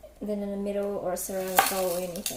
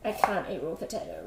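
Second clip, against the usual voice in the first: usual speaking voice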